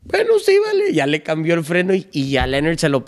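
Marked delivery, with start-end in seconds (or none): 0.0-0.9 much higher; a high, easy yes; mock dad voice. Dad agreeing to train the horse, much higher. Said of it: "Dice mi papá".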